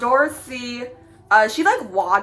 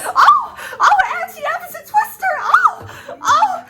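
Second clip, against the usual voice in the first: Dorothy voice. Retold or imitated. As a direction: shout it high like panicked Dorothy. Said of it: "do you want me to do how she did".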